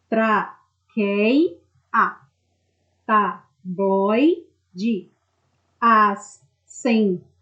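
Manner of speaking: TRAQUEIA, TABLOIDE, ASSEMBLEIA broken into slow, separate syllables. Shown as a demonstration. Teacher separates syllables for students, traqueia, tabloide, assembleia said syllable by syllable. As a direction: split traqueia, tabloide, assembleia at each syllable, with clear pauses between. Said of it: "Quando vocês separam as sílabas dessas palavras".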